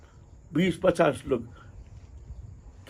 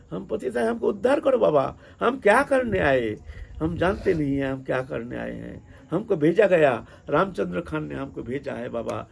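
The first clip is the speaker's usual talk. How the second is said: frantic tearful pleading. Retold or imitated. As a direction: act out crying out to be saved, then sink away exhausted. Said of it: "पागल जैसे वहां रोना शुरू कर दिया".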